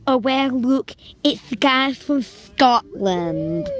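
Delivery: loud, high, sneering drawl; taunting bully. Retold or imitated. Girl taunting Louis, loud and high. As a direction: savour each well like a villain.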